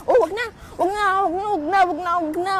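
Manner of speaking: urgent hushing. Pretend. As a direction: beg her to stop telling the story.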